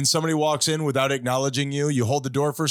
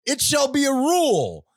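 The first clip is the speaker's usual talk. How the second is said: town crier; 7 semitones higher, slower than usual. Acted. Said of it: "now I am unrolling my scroll".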